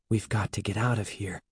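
quick, hushed aside; soft, hurried near-whisper; urgent whisper. A narrator's reading. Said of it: "Evan whispered to Andy".

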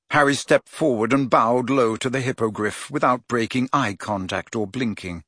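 ordinary delivery

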